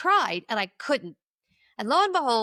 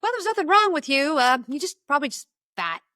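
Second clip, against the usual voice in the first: dismissive counselor; 5 semitones higher, 3 dB louder than usual. Retold or imitated. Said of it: "She goes".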